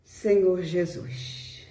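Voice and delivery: ordinary talk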